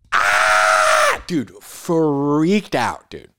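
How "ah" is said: put-on loud shriek of a scared kid; startled scream. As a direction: yelp as someone appears behind you. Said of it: "Then I'm just like".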